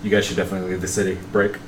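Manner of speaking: radio voice. Acted. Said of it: "You guys hear Karen come on".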